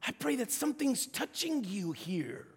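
ordinary talk, nothing marked

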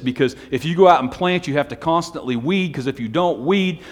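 forceful sermon delivery, voice lifted; impassioned preaching. Real, not acted. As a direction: drive the point home to the congregation. Preacher continues at full force.

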